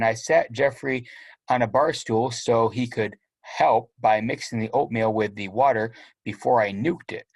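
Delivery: plain reading voice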